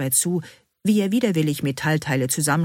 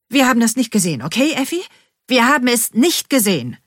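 urgent insistence; 7 semitones higher, 6 dB louder than usual. A narrator's reading. Bean urges a pact of denial.

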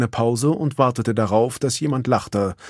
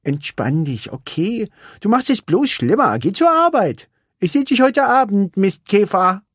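put-on higher, louder girlish complaint; sulky little racer; Vanellope; 10 semitones higher, 4 dB louder than usual. A narrator's reading. Vanellope complains to Ralf, higher and sulky.

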